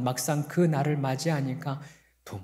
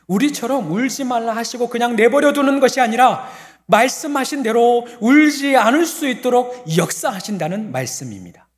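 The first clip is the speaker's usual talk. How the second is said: higher, louder sermon climax; full-force preaching; 10 semitones higher, 9 dB louder than usual. Real, not acted. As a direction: drive the point home. (힘차게 외치는 설교)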